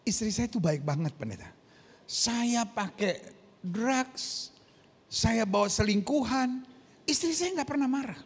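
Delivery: lightly voiced husband, soft and halting; meek husband. Voices husband praising tolerant wife.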